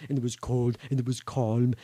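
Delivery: low, soft storytelling; hushed narration. A narrator's reading. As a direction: drop down soft and low for the calm. Narrator stills the scene after shooting.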